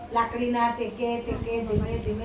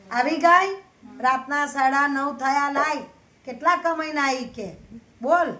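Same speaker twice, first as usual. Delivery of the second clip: higher, louder shrill demand, slowed on the hour; angry scold; 4 semitones higher, 4 dB louder than usual. Acted. Mother-in-law scolds her daughter-in-law for the late hour, higher and louder. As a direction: bark at her for coming home so late.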